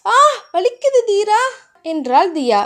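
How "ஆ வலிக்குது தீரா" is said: high, pained ouch and whine; acted little cry of pain. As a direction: yelp as if bitten. Girl hurt, cries out in pain.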